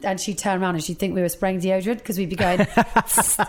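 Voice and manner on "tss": soft hissing spray noise through teeth; quiet aerosol hiss. Retold or imitated. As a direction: make a deodorant spray sound. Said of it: "that's me making a noise with my mouth".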